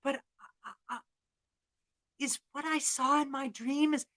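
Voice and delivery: high, hesitant apology; contrite Sean O'Sullivan. Acted. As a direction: stammer it out, fading to a small worried question. Contrite Sean pleads, fearing dream.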